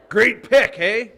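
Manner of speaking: laughing mock praise; laughing tease. Real, not acted. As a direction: crack up while ribbing him. Mocking friend's bad pick.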